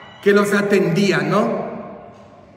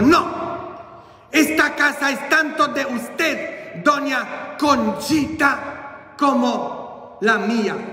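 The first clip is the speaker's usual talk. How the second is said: high, slowing exasperated insistence; fed-up priest; 7 semitones higher, slower than usual. Acted. Priest corrects his housekeeper about the house, acted exasperation. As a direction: insist high, stretching the last words out. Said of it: "el padre Agapito ya estaba harto".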